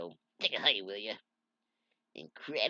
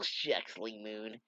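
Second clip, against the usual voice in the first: usual speaking voice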